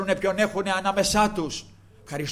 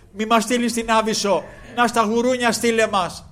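higher, louder pleading demons; begging demons; 7 semitones higher, 7 dB louder than usual. Acted. Demons beg Christ. (οι δαίμονες ικετεύουν δυνατά και ψιλά)

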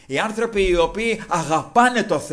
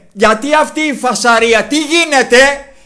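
loud, higher-pitched acted question; raised questioning; 7 semitones higher, 8 dB louder than usual. Blind beggar asking the passers-by, raised questioning. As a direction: call out over the crowd, stretching the end. Blind man asks about the commotion.

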